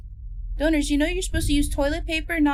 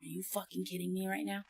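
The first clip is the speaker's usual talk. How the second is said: muttered disbelief; 7 semitones lower, 15 dB quieter than usual. Real, not acted. Exasperated at the game.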